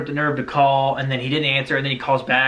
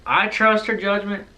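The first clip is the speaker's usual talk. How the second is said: put-on easygoing dad with a thick accent, pitched up; Barry; 6 semitones higher than usual. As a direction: imitate a country father giving his approval. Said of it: "thick accent".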